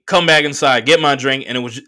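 ordinary delivery

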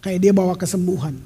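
ordinary talk

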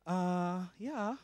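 flat, hesitant and soft; a reluctant mumbled yes; lukewarm answer. Shown as a demonstration. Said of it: "you never say".

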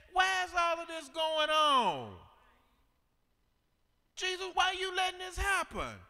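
high, loud, crying out to God; preaching at full force. Acted. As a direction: whoop the congregation up.

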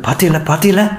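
lightly acted indignant nagging; mild scolding. A narrator's reading. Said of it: "மாத்தி மாத்தி என்னை பத்தி திட்டுக்கிட்டு".

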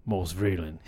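suddenly low, soft name drop; different name voice. Acted. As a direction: answer the clerk in a low, cool voice. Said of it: "do a different voice every time".

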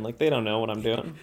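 ordinary talk, nothing marked

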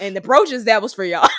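hammed-up shrug about the brooches; quoting a bandmate with mock importance; mock dismissive aside. Imitating [name] on brooches.

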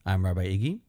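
normal talking voice

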